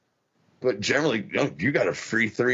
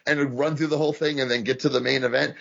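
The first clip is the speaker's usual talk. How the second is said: usual speaking voice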